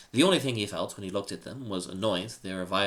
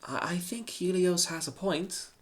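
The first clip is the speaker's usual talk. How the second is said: timid mumble; 10 semitones higher, slower than usual. Acted. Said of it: "Daphne mumbled timidly".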